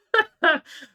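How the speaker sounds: sudden loud, high-pitched laugh. Real, not acted. Laughs at own indignation.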